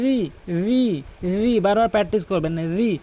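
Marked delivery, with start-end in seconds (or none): 0.0-1.6 slowly, tongue tip to teeth edge on "রি"; correct dhal sound. Shown as a demonstration. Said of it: "জালের উচ্চারণ হচ্ছে জিহ্বার আগা". 2.8-3.0 tongue tip touches the teeth on "রি"; correct dhal sound. Shown as a demonstration. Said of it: "রি বলতে হবে".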